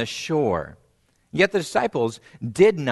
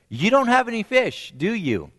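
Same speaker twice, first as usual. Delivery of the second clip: teasing question; 9 semitones higher than usual. Acted. Voices Jesus' teasing question.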